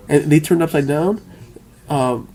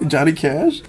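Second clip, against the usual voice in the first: usual speaking voice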